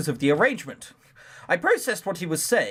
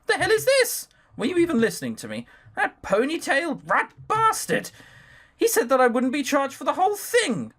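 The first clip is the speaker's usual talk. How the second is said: high-pitched indignant outburst; exasperated protest, voice pitched high; angry outburst; 9 semitones higher than usual. Acted. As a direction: blurt it out in outrage, pitch up. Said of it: "my outburst".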